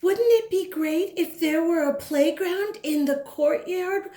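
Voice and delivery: put-on high, eager little girl asking; bright child voice. Child character asks Mama.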